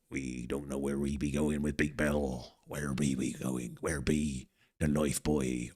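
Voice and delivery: a pirate wondering where to sail. Pretend. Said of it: "keep the pirate thing up".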